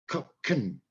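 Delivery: isolated "can" said as short "kun"; weak "can". Shown as a demonstration. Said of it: "So I've changed the word can".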